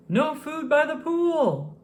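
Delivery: high, slow, loud lifeguard yell; startling lifeguard yell. A narrator's reading. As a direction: bark the rule down at the kids. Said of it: "the lifeguard yelled".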